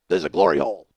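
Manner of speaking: low, loud grouchy growl; full-throated gruff puppet grump; Oscar the Grouch. Acted. Stranger explaining through a hole in the wall, grouchy. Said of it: "He sounds like Oscar the Grouch".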